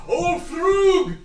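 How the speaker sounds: high shouted call across the crowd; an elder hailing old Frug. Acted. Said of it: "he looks over and goes".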